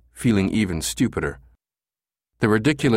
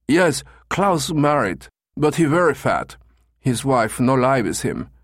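put-on German accent; 6 semitones higher than usual. Caller explains his brother's marriage, high German accent. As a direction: play a German tourist in halting English. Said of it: "in his German accent".

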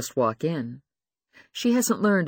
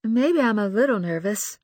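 higher, admitting nervousness; nervous young woman; 8 semitones higher, 3 dB louder than usual. Acted. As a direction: confess it nervously. Lucy voiced, nervous.

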